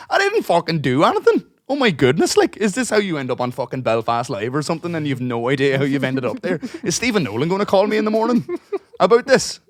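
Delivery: high, loud, incredulous rant with laughter creeping in; exasperated protest. Retold or imitated. Retelling a protest to a staring bar, high exasperated rant. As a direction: protest your innocence, pitched right up.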